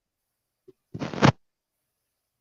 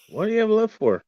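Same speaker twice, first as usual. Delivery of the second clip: much higher, forceful challenge; 11 semitones higher than usual. Acted. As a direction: fire the question at them. Same question hushed then yelled.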